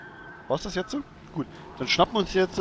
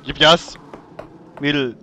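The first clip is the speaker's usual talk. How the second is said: louder, bossy hurry-up; mock impatient; 8 dB louder than usual. Real, not acted. Gamer urging his companion to hurry, loud. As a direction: push her to hurry, mock stern.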